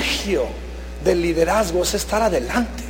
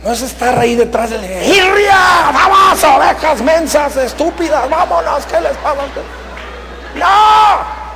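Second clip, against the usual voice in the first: mock angry herding shout; 5 semitones higher than usual. Herder shouts at stupid sheep behind him, hammed-up fury. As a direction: bark at the flock like a fed-up herder.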